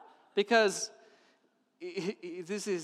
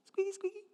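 tiny high, soft squeak; squeaky window noise; 12 semitones higher, 7 dB quieter than usual. Retold or imitated. Little girl cleaning the window, squeaking. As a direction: squeak like a cloth on glass.

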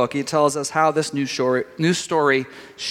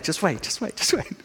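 softer, soothing just wait; hushed reassurance; 7 dB quieter than usual. Real, not acted. A parent hushes her sons angry at the coach, softly.

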